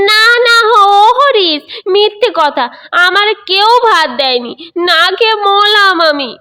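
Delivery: put-on high, loud sing-song old man's lament; wailing old grandfather. Old grandfather wailing to his son that nobody fed him. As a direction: whine like a cheated old child.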